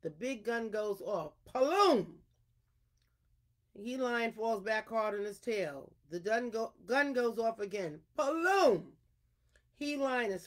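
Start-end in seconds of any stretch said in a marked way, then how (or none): 1.5-2.2 loud, high gun boom; imitated gunshot. Imitating the big gun going off. 8.2-8.9 loud, high gun boom; imitated gunshot. Imitating the gun firing again.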